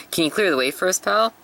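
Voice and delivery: bright cheeky ask, jumping up at the end; cheeky high call. Acted. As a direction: pitch rising up high on pal. Luffy calling to the soldier.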